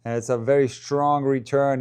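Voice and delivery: normal talking voice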